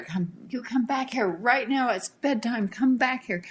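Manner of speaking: high-pitched mock yell calling a child back; retold yelling. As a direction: imitate a mother yelling at a runaway toddler. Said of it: "her mother was yelling at her".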